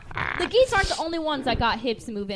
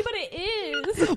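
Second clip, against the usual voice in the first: ordinary talk, nothing marked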